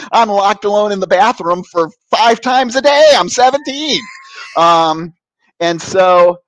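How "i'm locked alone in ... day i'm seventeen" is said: high, loud comic protest; exasperated outburst. Retold or imitated. Seventeen-year-old pleading hormones to priest, exasperated. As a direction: yelp it as an outraged plea. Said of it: "popped right off on him".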